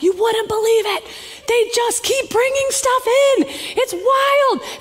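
high, excited telling a friend; bubbling over with disbelief; retelling her amazed phone call, excitedly; amazed gushing. Retold or imitated.

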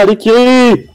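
high, loud cheering shout. Real, not acted. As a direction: cheer the player on at full voice. Caster cheering on a player. (cri d'encouragement)

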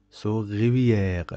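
slow rivière, long E, French R. Shown as a demonstration. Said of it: "make the pronunciation of this E long".

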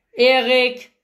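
loud, icy call of the name; cold greeting. A narrator's reading. Cowboy coldly greets the sister's brother, in an icy voice. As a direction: greet him coldly across the yard. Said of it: "erklang Patricks unterkühlte Stimme".

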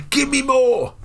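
high mock yell at the team; passive-aggressive demand. Manager to his players at half-time, a high passive-aggressive yell. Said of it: "Get into their heads passive-aggressively".

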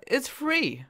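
ordinary delivery

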